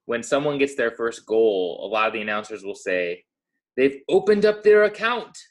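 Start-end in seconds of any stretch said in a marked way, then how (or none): 3.8-5.4 mock British announcer, higher and louder; a bad put-on English accent; hammed-up British commentator voice; terrible English accent. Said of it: "that was my terrible English accent".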